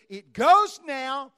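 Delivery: commanding declaration. Acted. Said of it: "God says".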